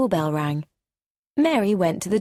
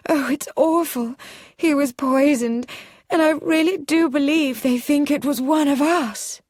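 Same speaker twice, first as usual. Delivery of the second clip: higher and louder, near hysterical; passionate outcry; 8 semitones higher, 4 dB louder than usual. A narrator's reading. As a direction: pour out the fear passionately. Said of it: "cried out passionately".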